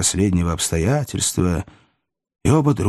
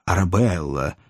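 ordinary delivery

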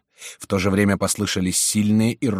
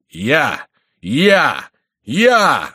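cocky shouts, each higher and louder; self-assured; 6 semitones higher, 7 dB louder, much slower than usual. A narrator's reading. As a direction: boast it in climbing steps. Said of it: "отвечал самоуверенно Горанфло". (самоуверенно, всё громче)

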